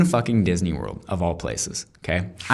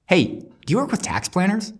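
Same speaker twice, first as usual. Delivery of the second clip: higher, lightly voiced eager stranger asking a question; retelling the tax planner's approach at Disney World, higher and eager; mock stranger's question; 11 semitones higher than usual. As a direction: play up the stranger's surprise opener. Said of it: "comes up and is like".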